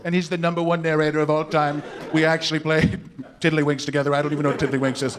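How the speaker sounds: level, self-assured monotone impression; boastful politician. Comic mimicking a candidate praising the narrator, boastful monotone. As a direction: brag evenly about a famous acquaintance.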